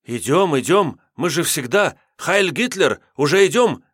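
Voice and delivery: high, loud, frantic assurance; panicked. A narrator's reading. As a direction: rush to assure them. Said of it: "панически".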